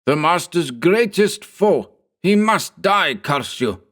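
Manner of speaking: a frenzied servant cursing; fanatical henchman. Acted. Henchman cursing the narrator, high and fierce. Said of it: "he hissed".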